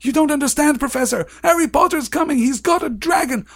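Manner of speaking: very high, urgent pleading; acted panicky, insistent excuse; frantic protest. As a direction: plead your case like a tattling schoolboy. Acted pupil protesting to teacher.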